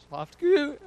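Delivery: high, loud call down the stairs; imitated yell. Grandma yelling down the stairs, high and loud. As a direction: shout it down to the basement. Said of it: "yell down the stairs".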